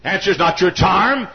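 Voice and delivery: rising to a high shouted cry; full-force preaching. Acted. Preacher vowing to his congregation to pray, shouting sermon. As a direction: proclaim it like a vow.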